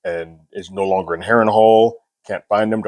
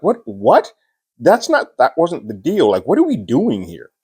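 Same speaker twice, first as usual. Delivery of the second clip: shocked disbelief; 7 semitones higher than usual. Retold or imitated. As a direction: voice leaps up in disbelief. Alicent stunned by betrayal.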